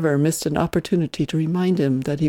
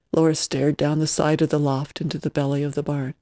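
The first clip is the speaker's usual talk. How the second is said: usual speaking voice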